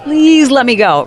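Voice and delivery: half-joking desperate inner plea; mock pleading. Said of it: "but you're like".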